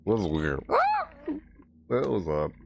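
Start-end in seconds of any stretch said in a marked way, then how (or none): 0.7-1.1 sudden tiny high squeak; a chipmunk yelp; glitch squeal. Real, not acted. Mic glitch turns his cry into a squeak.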